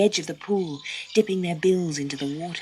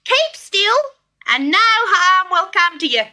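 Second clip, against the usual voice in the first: high, loud cheerful bird voice; a jolly kookaburra calling down from the tree; 9 dB louder than usual. Acted. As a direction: kookaburra promising to kill the snake, high and loud. Kookaburra speaks to Dot.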